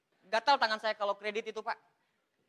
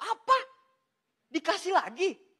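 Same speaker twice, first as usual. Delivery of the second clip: high, loud disbelieving outburst; exaggerated stunned reaction; mock shock; 9 semitones higher, 6 dB louder, slower than usual. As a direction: act out astonished disbelief, pitched way up. Acts out astonishment at another payout.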